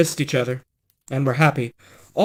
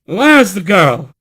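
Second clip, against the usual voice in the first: loud, slow demand; insist, every word weighted; 4 semitones higher, 7 dB louder than usual. Acted. Prince demanding to see the maid, loud and slow.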